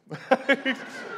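a sudden high laugh; high burst of laughter. Real, not acted. Laughing at the kid's boast, a sudden high laugh.

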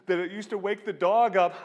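high, loud punchline; imitated laughing-fit delivery; cracking up. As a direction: squeak it out as if overcome by hilarity. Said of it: "they're just cracking up".

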